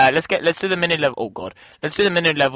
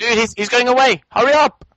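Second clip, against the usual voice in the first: high-pitched, frantic urging; urgent yell; 10 semitones higher, 5 dB louder than usual. Real, not acted. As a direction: yell at your teammate to hurry. Urging co-player during game.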